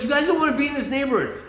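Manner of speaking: high-pitched imitation of alarm; urgent warning. Ambulance guy warning bikers off the neighborhood, high and urgent.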